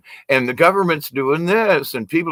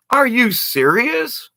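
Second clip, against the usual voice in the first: incredulous; 9 semitones higher, slower than usual. Real, not acted. Retorting to critics.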